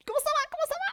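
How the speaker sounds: very high squeaky playful hello; squeaky greeting. Pretend. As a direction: squeak it out like a cartoon.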